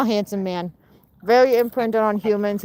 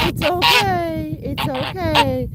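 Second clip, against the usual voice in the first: calm a nervous pet; soothing; 8 semitones higher, much slower than usual. Real, not acted.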